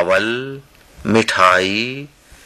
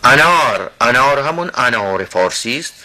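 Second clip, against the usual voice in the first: ordinary talk, nothing marked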